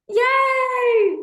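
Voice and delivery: high, loud cheer of relief; excited yay. Real, not acted. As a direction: cheer like everyone survived. Host celebrating after technical trouble.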